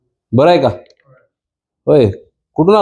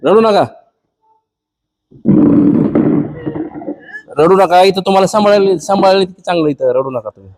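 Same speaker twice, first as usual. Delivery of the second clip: consoling; 8 semitones higher than usual. Real, not acted. Vlogger comforting the weeping grandmother, raised and gentle. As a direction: soothe her, urging her not to cry.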